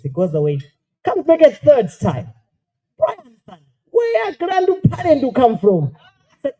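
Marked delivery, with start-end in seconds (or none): 3.0-5.9 put-on very high, drawn-out Japanese-accented question; mock Japanese child. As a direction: imitate an insistent Japanese kid.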